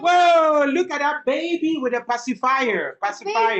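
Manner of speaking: high loud whoa; high loud playful exclamation; excited. Pretend. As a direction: exclaim over the picture for the child. Excited picture reaction for child.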